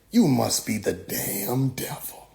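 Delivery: hushed, low, slow accusation; lightly acted pastor sizing up the devil; name the devil slowly under the breath; accusing pastor. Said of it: "anytime I asked a pastor".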